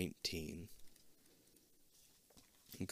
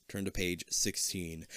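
ordinary talk

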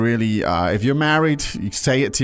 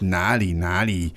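humble deflection; 5 semitones lower than usual. Retold or imitated. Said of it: "the Chinese guy is being".

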